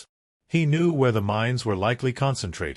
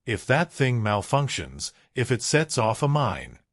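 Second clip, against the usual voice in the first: ordinary delivery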